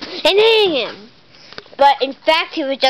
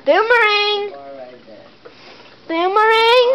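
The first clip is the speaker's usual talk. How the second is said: raised call of the name; 5 semitones higher than usual. Pretend. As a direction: shout it across the room. Calling the missing bird.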